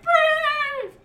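very high, loud held yell; school crowd yell. Retold or imitated. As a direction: shriek it out like a whole pep rally. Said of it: "we would all be like".

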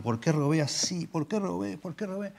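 high, whiny mock lament of a caught thief; regretful thief. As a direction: play the thief sulking over a botched job. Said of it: "Y está diciendo".

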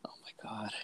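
breathy aside, very soft; whispering. Real, not acted. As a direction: hush it under your breath. Unvoiced whisper reaction.

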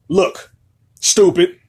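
normal talking voice

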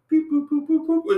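squeaky high boops, one after another; high boop sounds. Retold or imitated. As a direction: pop them out high like blessings dropping. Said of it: "and things are just being added".

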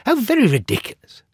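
high, fast mocking cry; scoffing. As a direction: laugh it off as absurd. Creatures scoff.

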